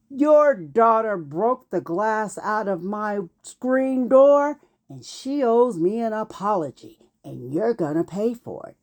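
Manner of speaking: ordinary talk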